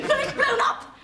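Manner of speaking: high, loud wail of alarm; panicked yell. Acted. Wife hearing of generator trouble, panicked yell. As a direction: cry disaster at the top of your voice.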